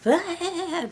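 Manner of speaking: high mock wail; imitated sob. Viewer hears how her sobs would sound, mock wailing. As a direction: burst out in a put-on bawl.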